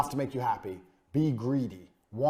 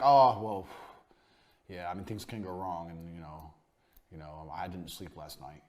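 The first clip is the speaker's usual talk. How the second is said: lower, softer, hesitant mock-nervous doctor; 8 semitones lower, 8 dB quieter than usual. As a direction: play an unsure surgeon mumbling doubts. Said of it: "or nervous?".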